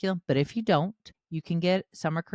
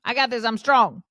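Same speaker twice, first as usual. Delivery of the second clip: much higher and louder, playful boast; mock tough mom; 5 semitones higher, 7 dB louder than usual. Mom playfully refuses her sons' help, loud and high. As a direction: puff up and insist you can do it. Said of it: "I'm like".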